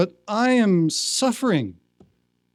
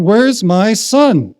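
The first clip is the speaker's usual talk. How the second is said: loud, long-drawn-out call; searching call; 4 semitones higher, 8 dB louder, much slower than usual. Retold or imitated. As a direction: call slowly into the next room. Swami calls out from another room.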